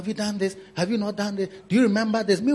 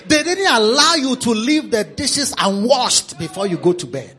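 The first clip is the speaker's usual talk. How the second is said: ordinary delivery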